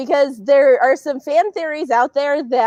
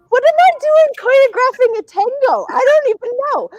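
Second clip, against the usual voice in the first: baffled; 12 semitones higher, 3 dB louder than usual. Retold or imitated. Self-mocking bewilderment.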